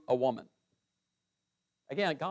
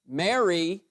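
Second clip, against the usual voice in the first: "Mary" called out louder and higher, rising; emphatic stress; 4 semitones higher, 6 dB louder than usual. Shown as a demonstration. Jesus calling Mary at the tomb, emphatic rising call. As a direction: lean hard on "Mary", lifting it. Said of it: "there's gotta be some emphatic stress there".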